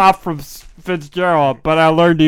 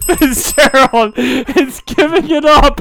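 laughing; 7 semitones higher, 3 dB louder, slower than usual. Real, not acted. Laughing after prank call.

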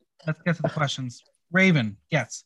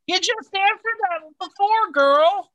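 much higher and louder, sassy, in a put-on voice; 14 semitones higher, 4 dB louder than usual. Real, not acted. Host to the contestant who repeated her joke, sassy put-on call-out. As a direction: read her like a judge calling out a contestant.

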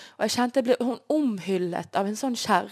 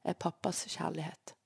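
inner voice; 2 semitones lower, 7 dB quieter, slower than usual. Retold or imitated. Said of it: "en sånn stemme inni meg".

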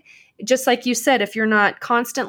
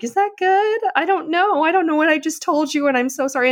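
much higher, flustered and apologetic; 6 semitones higher than usual. Retold or imitated. Told her energy is contagious, answering in a flustered high voice. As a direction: squeak out a confused apology. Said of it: "And I'm like".

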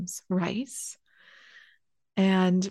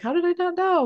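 amazed self-question; 11 semitones higher than usual. Retold or imitated. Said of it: "It's like".

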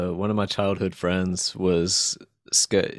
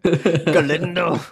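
high, loud, drawn-out Scottish echo; mock Scottish accent; 9 semitones higher, 5 dB louder, much slower than usual. Listener echoes buddy's place name, mock Scottish accent. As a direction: parrot the Scottish pronunciation, stretching it out.

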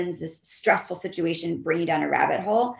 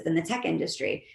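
normal talking voice

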